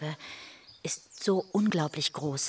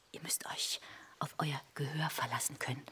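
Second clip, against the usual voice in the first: hushed warning; 10 dB quieter than usual. Acted. Mother warns about hearing.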